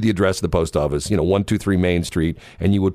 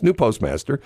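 ordinary delivery

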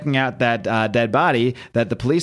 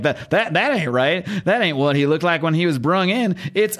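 put-on Southern accent; 5 semitones higher than usual. Baffled medical examiner checking a body, in a put-on Southern accent. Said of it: "why I'm giving him this accent".

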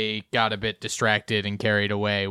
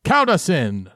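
loud, high proclamation; big hyped reply; bandmate in character; 7 semitones higher, 7 dB louder, slower than usual. Acted. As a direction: call grandly for the count-in.

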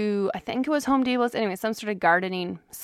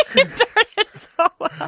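talking through giggles, pitched up; laughing; 10 semitones higher, 4 dB louder, slower than usual. Real, not acted. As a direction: giggle the words out. Giggling at the cheesy question.

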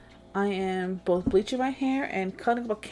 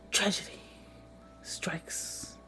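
dramatic whisper; 11 dB quieter, much slower than usual. Real, not acted. Dramatic aside in summary.

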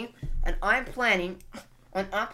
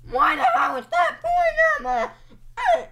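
voice crack; 9 dB louder than usual. Real, not acted. Vlogger yells at a boy, voice cracking up high and loud. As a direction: shout that cracks far up high. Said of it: "Was that a voice crack?".

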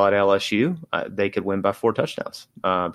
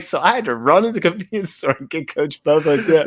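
high-pitched, laughing voice; laughing retelling; 8 semitones higher than usual. Retold or imitated. Host retelling player's errand for coach, cracking up. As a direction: squeeze the punchline out through laughter.

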